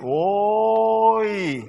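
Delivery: long drawn-out Central-style "Oi"; Central regional exclamation. Retold or imitated. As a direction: stretch the Oi as people from Central do. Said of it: "a funny expression that I hear people from Central".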